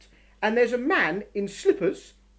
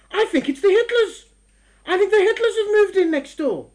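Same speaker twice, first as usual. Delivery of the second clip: higher and louder, half laughing in disbelief; incredulous report; 10 semitones higher, 8 dB louder than usual. Acted. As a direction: break the absurd news. Reporting the Hitlers moved in.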